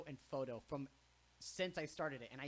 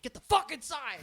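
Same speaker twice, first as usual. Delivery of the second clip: full-force yell imitating a bandmate; high, very loud retold command; shouted order; 13 semitones higher, 17 dB louder than usual. Retelling bandmate ordering him inside.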